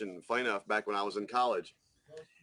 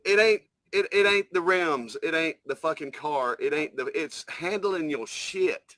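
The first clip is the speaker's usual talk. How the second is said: higher, forceful lecturing voice; stern lecture; 6 semitones higher than usual. Retold or imitated. Telling the armed kid off: raised, forceful lecture. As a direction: drive the lesson home, firm and raised. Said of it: "He said".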